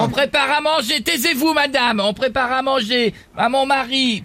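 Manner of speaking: housewife character voice. Acted. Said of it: "Madame Pélissard avec nous".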